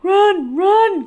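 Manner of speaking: high, loud frantic cry; panicked shout. Acted. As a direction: yell to flee. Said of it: "cried Hedgehog".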